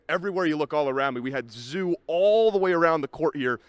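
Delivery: ordinary talk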